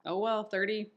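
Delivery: usual speaking voice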